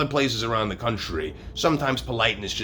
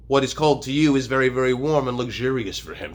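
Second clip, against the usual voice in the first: normal talking voice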